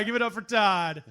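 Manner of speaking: ordinary talk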